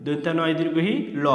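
usual speaking voice

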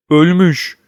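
ordinary delivery

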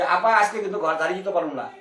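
loose everyday Dhaka dialect with a laugh; a maid's casual dialect; mock maid in local dialect; Bangladeshi maid dialect. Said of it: "আমগো লোক এখানে কাজের লোক বলবো".

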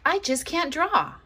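put-on high, frustrated child's voice; high-pitched frustrated girl insisting; girl's frustrated cry. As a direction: lift it high and fed up like a girl. Vashti protests.

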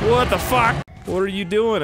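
hoger en luider, verbijsterd; shocked outburst. Real, not acted. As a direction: schreeuw verbaasd uit. Shocked at dragon's action.

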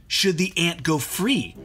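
ordinary delivery

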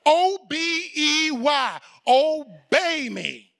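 each letter spelled slowly, then obey me drawn out. Shown as a demonstration. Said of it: "Okay? If you can just catch that".

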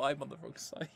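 normal talking voice

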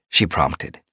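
ordinary delivery

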